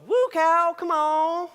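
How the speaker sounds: high, loud calling-in-the-cows voice; a high farmyard holler to the cows; grandmother calling cows. Retold or imitated. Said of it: "like that".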